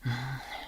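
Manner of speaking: sad sigh. Acted. Lovers parting, she is sad.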